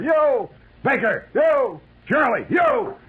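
high, loud alternating name and answer; loud roll call back and forth; roll call shouts. Retold or imitated. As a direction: sergeant bellows, soldiers bark back. Acted roll call in the dark.

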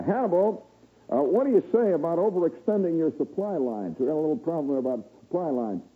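high, fast folksy president; eager bumbling politician asking favors. Retold or imitated. President replies to Hannibal.

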